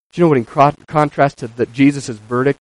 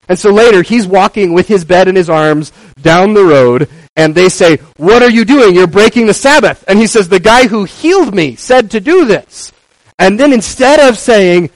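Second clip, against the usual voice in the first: fervent preaching; 7 semitones higher, 8 dB louder than usual. Acted. Preaching at full force.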